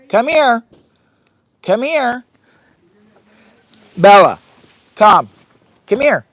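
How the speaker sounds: high, loud call to the dog. Real, not acted. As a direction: call her over from across the tent. Calling the dog to come.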